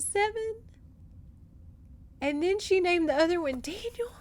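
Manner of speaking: high voice choking up with long pauses; tearful. Real, not acted. Reader retells the twins' naming, tearful. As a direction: squeeze the words out through tears. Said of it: "I was crying".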